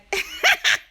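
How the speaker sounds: high-pitched burst of laughter; laughing at own thought. Real, not acted. Host breaks off at a thought too naughty to say, laughing. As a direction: crack up helplessly at your own thought. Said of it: "I'm laughing at my thought".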